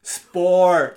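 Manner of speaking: loud, over-enunciated command; voice command to a machine. Pretend. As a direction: bark it at a phone menu. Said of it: "Can you say that one more".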